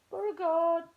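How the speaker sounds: loud call to the dog. Real, not acted. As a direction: call the dog's name. Calling the dog again.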